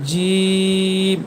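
জিম with a very long "ee" closing on m; mid-tongue to palate, sustained জিম; full letter sound. Shown as a demonstration. Teacher demonstrating the letter jim, long held জিম. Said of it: "এই জিম হরফটি উচ্চারণ করবেন".

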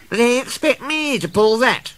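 high-pitched, affronted question; indignant. A narrator's reading. Daisy sees the van to be coupled, indignant. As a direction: ask as if deeply insulted. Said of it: "she asked indignantly".

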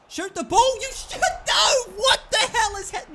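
high, loud panicked shouting; frantic yell. Real, not acted. Gamer yelling at Ramos to shoot, frantic. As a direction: scream at him to shoot.

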